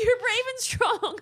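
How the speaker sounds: high, slow laughing-through-tears reassurance; tearful comfort. Real, not acted. Sister comforting her sister through tears after the announcement.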